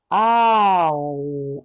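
あーおー held long, sliding to a vowel between a and o. Shown as a demonstration. Teacher modelling the vowel in thought. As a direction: hold あーおー and stop halfway. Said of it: "この間で止める".

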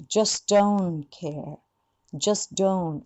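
very slow "just don't care", unreleased t; slow model. Shown as a demonstration. Teacher modelling the line slowly for students. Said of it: "えー、that you".

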